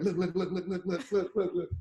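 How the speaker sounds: repeated "look" broken up by laughter; imitating Dave laughing. Retelling Dave at the party, laughing while pointing. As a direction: mimic a friend cracking up and pointing. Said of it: "He's dying laughing, tapping everywhere".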